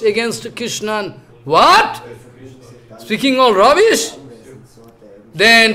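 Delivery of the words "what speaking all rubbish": sharp high What, disbelieving; outraged surprise. Acted. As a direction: react as if you can't believe the insult. Balaram hears Duryodhana's insults.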